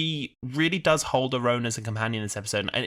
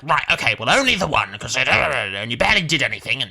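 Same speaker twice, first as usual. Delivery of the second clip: hurried, louder imitation of brushing her off; mock brusque backtracking; 5 dB louder, faster than usual. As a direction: rattle off excuses in mock fluster. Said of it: "he's like".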